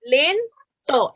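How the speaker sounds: "Lento" as len, then to; two-beat "Lento"; split into syllables. Shown as a demonstration. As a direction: pause before the last syllable of "Lento". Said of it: "que repita esta última".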